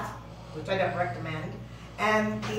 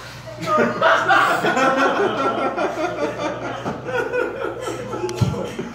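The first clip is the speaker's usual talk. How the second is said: frothing cat noise; 4 semitones higher than usual. Retold or imitated. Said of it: "This is really funny if I can pull it off".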